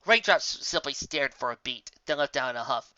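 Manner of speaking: ordinary delivery, nothing marked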